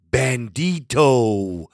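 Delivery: deep, slow dramatic name drop; dramatic announcer. Acted. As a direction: stretch the name out like a ring announcer. Host dramatically announces caller name.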